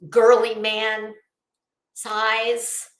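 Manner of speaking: normal talking voice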